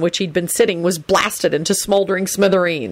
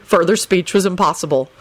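ordinary delivery

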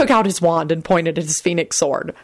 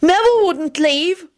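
high, earnest declaration; loyal intoning; 12 semitones higher than usual. A narrator's reading. Timothy standing up for Neville, loyally intoned. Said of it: "Timothy intoned loyally".

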